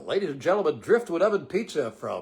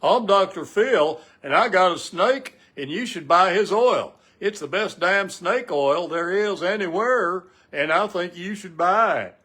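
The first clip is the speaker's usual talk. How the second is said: loud, slow mock pitchman; hammed-up TV salesman pushing snake oil; Doctor Phil impression; 3 semitones higher, 6 dB louder, slower than usual. Mocking Doctor Phil's TV snake oil pitch, loud and slow. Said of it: "Did you see that Doctor Phil".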